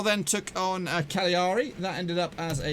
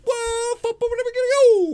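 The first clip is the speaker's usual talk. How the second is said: high, loud excited whoop; hyped shout; 9 dB louder than usual. Acted. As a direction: launch it like a showman's big cry. Hyped-up intro shout to viewers.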